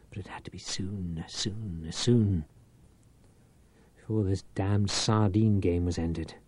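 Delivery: hushed, insistent, fading repeat; urgent whisper. A narrator's reading. Reich urges himself on, whispering the repeated soon. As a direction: whisper it to yourself, pressing.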